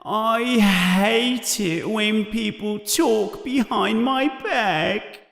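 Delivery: high, slow, mournful moan; wounded ghost feeling sorry for herself; sad voice. A narrator's reading. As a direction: draw it out in a high, hurt whine. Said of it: "says Myrtle in a sad voice".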